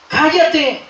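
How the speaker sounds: high-pitched rebuke, slowing at the end; scolding. Acted. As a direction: order someone to be silent. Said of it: "Muchos le reprendían". (reprensión en tono alto)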